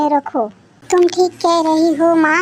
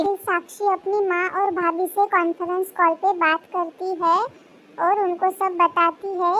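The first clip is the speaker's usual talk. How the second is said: story narration; 3 semitones higher, 7 dB quieter than usual. A narrator's reading. Narrator quietly describes what the daughter-in-law does, soft storytelling. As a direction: narrate the scene softly, like a story told.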